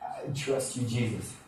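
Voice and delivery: soft, throaty, barely-there prayer; little throaty. Retold or imitated. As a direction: say it small, from the throat. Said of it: "like, little throaty".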